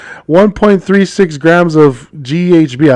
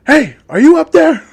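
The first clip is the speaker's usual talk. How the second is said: high-pitched call up a tree; 12 semitones higher than usual. Acted. Police officer hailing a fugitive in a tree, calling up. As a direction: call up to someone hidden overhead.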